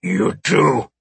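forceful alien voice; rough alien command; alien's order. Acted. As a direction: order him down as an alien captor. Alien orders second man down.

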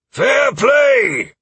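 high, loud shout across the field; shouted ruling from a drill master. Acted. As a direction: call it out at full volume. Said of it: "Aldwin shouted".

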